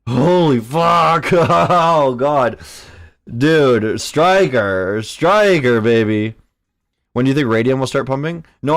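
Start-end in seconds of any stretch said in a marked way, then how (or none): 0.1-6.4 loud, drawn-out amazement breaking into a laugh; thrilled outburst. Real, not acted. Trader cheering a coin on, real thrilled outburst. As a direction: cry out in delight, stretching the key words.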